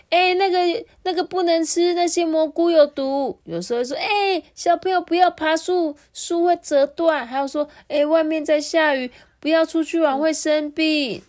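a mother calling out warnings to her little one. Acted. (妈妈高声提醒孩子)

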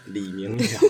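put-on Taiwanese-accented Mandarin. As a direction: say the name the way a Taiwanese dad would. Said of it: "你是用台湾国语讲".

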